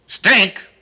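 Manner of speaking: stink roared high and loud; furious shout. Acted. Reporter threatening the French governor, shouting. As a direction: blast it at him in outrage.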